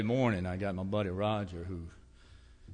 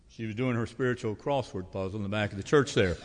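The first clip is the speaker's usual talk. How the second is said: ordinary talk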